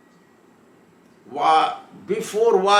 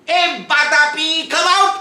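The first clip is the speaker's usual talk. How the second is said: high, loud call to his brother; shouting out; 10 semitones higher, 7 dB louder than usual. Retold or imitated. Atapi calls his brother out of the sage's belly, shouting. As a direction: yell him out, voice way up. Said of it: "He shouted again".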